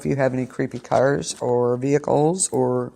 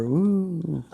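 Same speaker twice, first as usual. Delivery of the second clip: spooky whisper; 4 semitones higher, 6 dB quieter than usual. Acted. Spooky aside about October.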